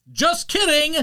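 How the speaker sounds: high, loud mocking sing-song; snatch it away with a mocking lilt; taunting announcer. Game voice taunting Carl, high loud mockery.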